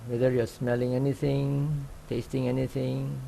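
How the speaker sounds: calm, even meditation guide with long pauses; unhurried instructions, leaving long silences between phrases; guided meditation. Real, not acted. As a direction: gently guide sitters into open awareness. Leads a sitting meditation.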